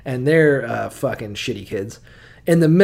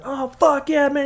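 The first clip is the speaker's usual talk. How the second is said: much higher, hyped cheer; thrilled Esau; 12 semitones higher than usual. Retold or imitated. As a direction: whoop it like a delighted uncle. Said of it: "And he's like".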